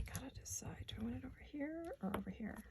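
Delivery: whispered thinking aloud; breathy under-the-breath deciding. Real, not acted. Whispering while deciding placement.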